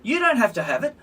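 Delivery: high, sweet mock reassurance; mocking officials. Mocking the officials who say it's optional, high and sugary. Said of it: "they're saying".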